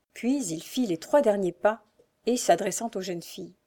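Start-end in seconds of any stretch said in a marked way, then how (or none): none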